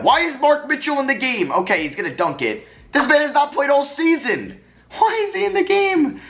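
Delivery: stunned why-is-he-playing complaint; exasperated disbelief. Real, not acted. Upset at a substitution.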